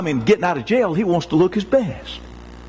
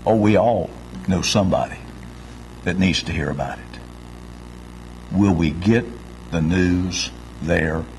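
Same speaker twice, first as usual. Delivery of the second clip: ordinary talk, nothing marked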